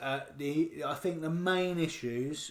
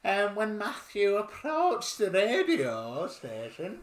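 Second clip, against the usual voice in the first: Margaret from Yorkshire; 7 semitones higher, 5 dB louder than usual. Pretend. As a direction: play a cheerful lady out for a drive. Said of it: "Margaret from Yorkshire".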